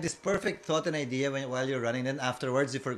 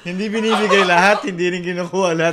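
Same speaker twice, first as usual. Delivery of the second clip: laughing; 9 dB louder than usual. Real, not acted. Laughing defense of himself.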